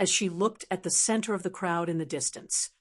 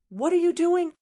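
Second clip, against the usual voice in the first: high, loud indignant question; glaring challenge; 9 semitones higher, 5 dB louder than usual. A narrator's reading. Subordinate confronting a scolding official, indignant challenge. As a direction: confront him with a sharp rise in pitch. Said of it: "glared at him and said".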